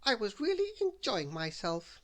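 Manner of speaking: restrained bossy character voice; Little Miss Bossy. A narrator's reading. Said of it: "she replied".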